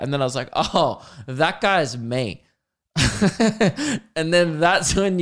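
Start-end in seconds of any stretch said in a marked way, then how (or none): 0.6-2.4 slow realisation. Retold or imitated. Slow realisation it's himself. 2.9-4.1 laughter climbing high at his own joke; rising burst of laughter. Real, not acted. Laughing at himself over his shadow, a rising burst of laughter.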